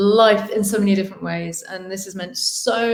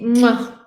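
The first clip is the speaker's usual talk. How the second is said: blown kiss; 3 semitones higher, 5 dB louder than usual. Real, not acted. Blowing a kiss.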